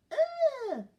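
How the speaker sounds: high, loud "ugh" in character; disgusted groan. A narrator's reading. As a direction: groan in high-pitched distaste. Goldilocks dislikes the soft bed.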